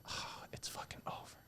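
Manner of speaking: whispered, breathy aside; awed whisper. Real, not acted. Imagining teaching own kid communication, awed whisper. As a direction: drop to a whisper in awe.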